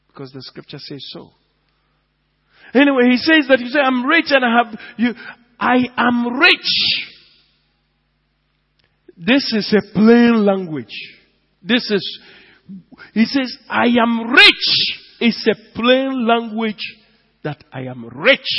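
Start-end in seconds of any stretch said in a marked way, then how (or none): 2.7-5.1 full-force preaching. Acted. Preaching the verse. 5.6-7.1 slow mock boast. Mocking the boastful church. 9.2-13.6 full-force preaching. Acted. Preaching the verse. 13.7-15.0 slow mock boast. Mocking the boast again.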